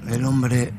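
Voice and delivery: ordinary delivery, nothing marked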